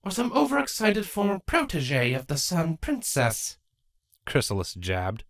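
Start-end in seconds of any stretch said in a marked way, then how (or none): 0.0-3.6 lightly voiced wry changeling queen; a hint of a teasing queen; queen's voice. Character voice Chrysalis.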